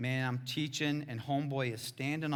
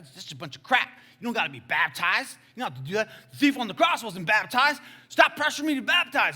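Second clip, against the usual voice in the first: heated retort climbing higher and louder; angry dude; 7 semitones higher, 10 dB louder than usual. Retold or imitated. Angry fighter guy refuses pressure to be baptized, building anger. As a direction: argue back, building to an exasperated shout. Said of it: "seems like kind of an angry dude".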